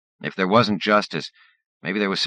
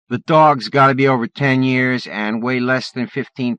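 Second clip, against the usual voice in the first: taunting bully; 9 semitones higher, 9 dB louder than usual. Acted.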